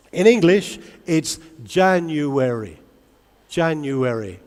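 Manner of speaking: "January" drawn out slowly, syllable by syllable; classroom word model. Shown as a demonstration. Teacher models a month for students, saying "January" slowly in syllables. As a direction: stretch "January" out so learners can count its syllables. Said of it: "This is how I teach".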